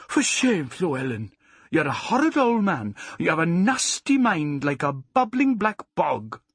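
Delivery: high, emphatic character scold. A narrator's reading. Farmer's wife shaming her husband, loudly scolding. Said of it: "Missus Pugh scolded him".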